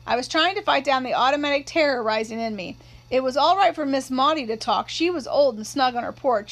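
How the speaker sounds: ordinary delivery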